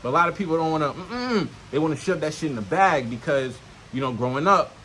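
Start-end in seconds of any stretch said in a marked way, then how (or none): none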